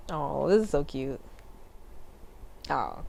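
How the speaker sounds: slow, soft and low, close to real tears; touched, on the edge of crying; tearing up. Real, not acted. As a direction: let the emotion catch you as you read. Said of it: "I'm actually tearing up".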